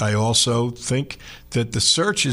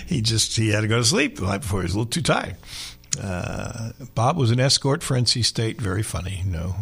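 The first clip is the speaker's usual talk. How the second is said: ordinary talk, nothing marked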